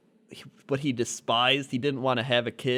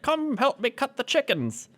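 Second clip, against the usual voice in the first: high cheery dad voice; 11 semitones higher than usual. Retold or imitated. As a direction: chirp it like an overfriendly dad. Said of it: "he's like".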